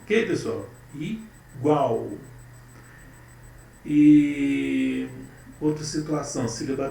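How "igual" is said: "igual" separated, i... GUAL, ending weighted; stress on GUAL. Shown as a demonstration. Teacher confirming for the class, "igual" stressed on GUAL. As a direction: break "igual" and land firmly on GUAL. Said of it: "O gual é a sílaba mais".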